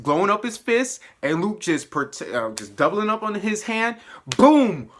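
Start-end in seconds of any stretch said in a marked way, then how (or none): 4.4-4.9 high, loud booming exclamations; explosive impact imitation. Viewer acting out Luke and Danny's combined punch, explosive shout. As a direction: punch out each hit.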